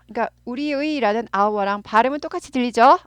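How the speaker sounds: ordinary talk, nothing marked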